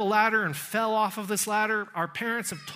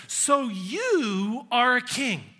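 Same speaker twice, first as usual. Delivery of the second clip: YOU drawn out and punched; stress on YOU; 2 semitones higher, much slower than usual. Acted. Pilate pushing Jesus about his claim, stress on YOU. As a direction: lean slowly on YOU. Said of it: "Greek carries an emphatic pronoun".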